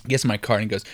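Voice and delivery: usual speaking voice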